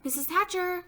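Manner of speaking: put-on high-pitched kid's call; eager girl calling. A narrator's reading. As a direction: call for a grown-up's attention. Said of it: "Sheila called".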